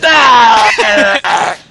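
high, loud drawn-out mock scream. Player joking about an injured character, loud mock scream. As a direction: scream out long as a joke. Mimicking a maimed game character.

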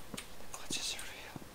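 slow, very soft whispered echo. Real, not acted. Player echoing own remark about game glitches, slow whisper. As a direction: whisper it slowly, barely audible.

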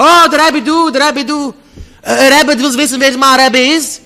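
high, loud jeering yell; mock shout. Kids taunting the Rebbe, a loud high jeer. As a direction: shout the taunt across the street.

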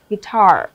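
"guitar" as gui-TAR; stress on second syllable. Shown as a demonstration. Teacher confirming the odd stress pattern, gui-TAR. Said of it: "là âm thức thứ hai".

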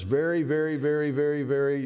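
very, very, very, each one punched; stressed repeated very. Shown as a demonstration. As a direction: lean on every very, building. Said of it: "do you hear me say very?".

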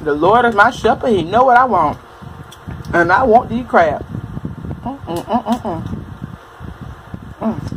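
chewing while talking; eating crab while speaking; mouth full. Real, not acted. Seen 0: Food goes into the mouth at -1; jaw and lips move while speaking.

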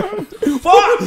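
high, loud laughing curse; shocked curse. Retold or imitated. Man catching his girlfriend cheating, laughing shocked curse. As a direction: blurt it out in shock, half laughing.

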